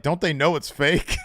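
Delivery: mock-baffled viewer, pitch way up; imitating her disbelief. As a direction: retell it high and incredulous. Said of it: "She's like".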